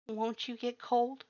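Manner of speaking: high, slow, small question; a hint of a timid girl's voice; lightly voiced girl. A narrator's reading.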